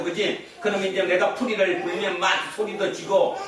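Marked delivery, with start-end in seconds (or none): none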